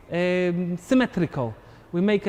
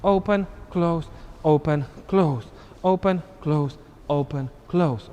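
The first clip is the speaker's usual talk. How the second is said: slow, steady "open, close"; teacher cueing arm moves; slower than usual. Shown as a demonstration. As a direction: cue the arms slowly. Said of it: "What is very important is this action".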